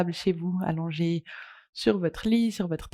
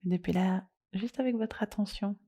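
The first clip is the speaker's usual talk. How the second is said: soft, unhurried yoga nidra guidance with long pauses; guided meditation; 5 dB quieter than usual. Acted. Leads a guided relaxation.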